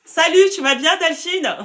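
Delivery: light up as you greet her; happy reunion call-out; excited greeting. Real, not acted. Greeting an old friend live.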